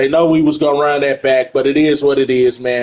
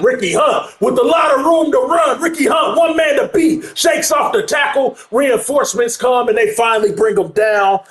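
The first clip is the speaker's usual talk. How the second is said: excited shout; 10 semitones higher than usual. Real, not acted. Commentator calling Ricky Hunt's long run, excited high shout. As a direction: call the breakaway run like it's a touchdown.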